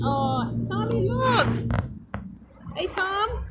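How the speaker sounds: slow, lilting call to a hurt little one, lifting high on look. Real, not acted. Cheering up hurt toddler.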